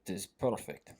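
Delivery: put-on low-key Russian accent. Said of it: "in a Russian accent as intensely as you can".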